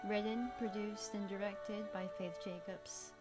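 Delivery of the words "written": ordinary talk, nothing marked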